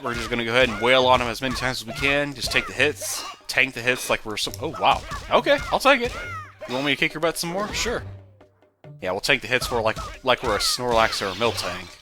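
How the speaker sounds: normal talking voice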